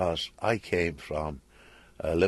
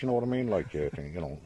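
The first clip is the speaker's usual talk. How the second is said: quick Liverpool Scouse, lower and softer; Scouse accent; 3 semitones lower, 6 dB quieter, faster than usual. Shown as a demonstration. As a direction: show off the street Scouse sound. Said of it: "And it always goes like this".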